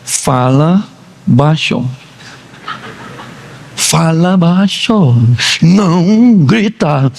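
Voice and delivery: low, slow hushing voice, playing the husband; husband hushing his upset wife in a low, slow voice. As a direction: tell her to keep it down, slowly and low. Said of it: "E você falou assim pra ela".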